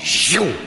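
loud, sudden zoom sound effect; one punchy burst of zoom; whooshing zoom. Retold or imitated. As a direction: make the card whoosh out. Imitates card whipped out.

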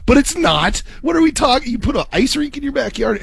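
high, incredulous mock protest; retold disbelief. Retelling reaction to coworker's rink, high incredulous mock protest. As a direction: act out being floored by the idea. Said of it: "I was beside myself shocked".

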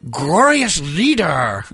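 slow, drawn-out mock Korean accent; over-slow parody Korean accent. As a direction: stretch glorious leader out slowly in a put-on Korean accent. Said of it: "Do a Korean from".

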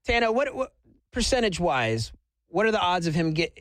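ordinary talk, nothing marked

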